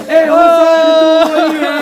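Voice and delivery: slow anguished mourning cry; wailing lament. Retold or imitated. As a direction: wail it over the body of a brother. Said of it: "रोकर कहा".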